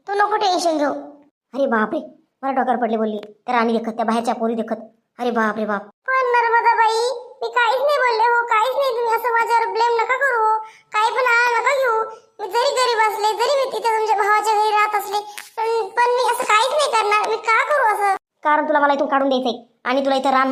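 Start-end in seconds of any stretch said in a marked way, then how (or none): 1.5-5.8 lower, fast, offended grumbling; a low voiced aunt taking mock offense; scandalised sister-in-law. Sister-in-law feigns outrage. 5.8-18.1 put-on high, pleading young woman's voice; a timid girl swearing she said nothing; innocent maid. Maid Rani denies blame.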